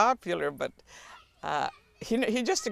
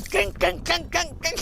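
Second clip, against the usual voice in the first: higher sing-song imitation of a clanging bell; streetcar bell; 10 semitones higher, 3 dB louder than usual. Mimicking the streetcar bell behind their car, higher. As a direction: chime gang gang like a trolley bell.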